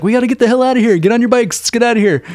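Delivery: high, urgent panicked plea; teen warning friends, high mock panic. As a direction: hurry them like monsters are coming. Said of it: "and is like".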